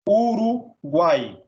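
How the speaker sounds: "Uruguai" slow, with "guai" held as one syllable; split slowly. Shown as a demonstration. Teacher showing the diphthong, slow split "Uruguai". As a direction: break "Uruguai" before an unbroken "guai". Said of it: "o guai fica na mesma sílaba".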